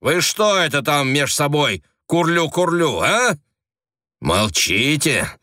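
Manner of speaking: high, sneering taunt; mocking. A narrator's reading. Senior agent taunting the family, mocking. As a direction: jeer at them.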